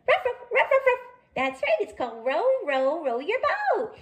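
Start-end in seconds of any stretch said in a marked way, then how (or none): 0.1-1.0 high, playful little dog barks; puppy barking. Acted. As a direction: bark like an excited puppy. [name] answers as a dog.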